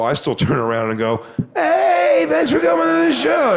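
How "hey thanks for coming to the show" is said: very high, goofy mock-friendly voice; jerk-off greeting. Comic turning to greet a fan after the show, silly high-pitched greeting. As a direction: squeal a gushing thanks, far above your own voice. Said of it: "The fucking jerk off I sound like".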